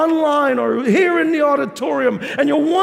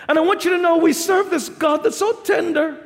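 ordinary talk

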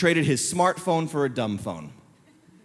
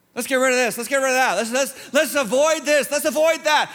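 higher-pitched acted urging; raised, insistent mock pleading; mimicked urgent resolve; 7 semitones higher, 3 dB louder than usual. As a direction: act out someone frantically rejecting things. Acts out people avoiding outside things.

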